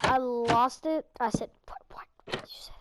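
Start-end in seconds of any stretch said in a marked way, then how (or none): 1.7-2.7 hushed whispered question; very soft, secretive whisper. Retold or imitated. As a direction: whisper it in disbelief to a friend. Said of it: "we like to whisper a lot".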